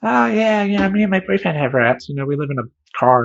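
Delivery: put-on high, airy casual girl voice; breezy, careless clerk; hip clerk voice.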